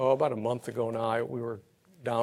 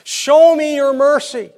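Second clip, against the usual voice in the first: slow, high, loud plea; psalmist's loud pleading cry; crying out to God; 10 semitones higher, 12 dB louder, slower than usual. Retold or imitated. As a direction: cry out like the psalmist begging for mercy. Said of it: "He cried out and he asked God".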